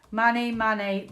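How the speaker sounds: normal talking voice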